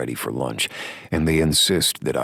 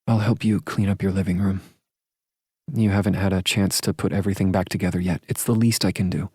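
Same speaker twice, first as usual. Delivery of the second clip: higher, quick, not taking no; brisk and insistent, pitched up; firm woman's voice; 7 semitones higher, faster than usual. A narrator's reading. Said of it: "she says with a tone that says she's not taking no".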